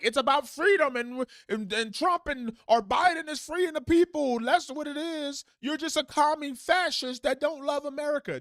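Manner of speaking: mocking a patriot who calls critics commies; mock patriot rant. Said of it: "But to say, like".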